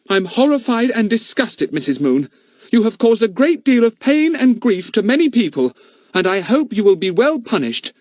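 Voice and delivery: high, indignant lady scolding the culprit; disgusted and reproachful; stern scolding voice. A narrator's reading. Lady scolds Missus Moon for causing grief, indignant.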